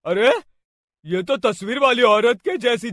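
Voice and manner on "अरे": ordinary delivery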